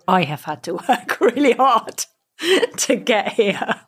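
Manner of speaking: breaking into a laugh mid-sentence. Real, not acted. Divorced mum admitting her own hard work, laughing. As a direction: say it through a rising laugh. Laughs while correcting herself.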